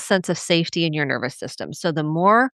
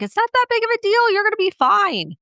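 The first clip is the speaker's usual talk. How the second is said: much higher, fast brushing-off voice; dismissive parent. Retold or imitated. Parent minimizing a child's fear, high fast mock voice. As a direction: rattle it off pitched up, waving it away.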